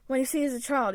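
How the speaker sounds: usual speaking voice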